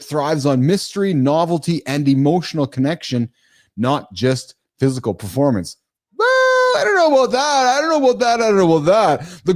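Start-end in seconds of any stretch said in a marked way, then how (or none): 6.2-9.4 doubtful. Real, not acted. Host doubting his own notes: incredulous, then settling. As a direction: push back on the script, fading each time. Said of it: "Whose notes are these?".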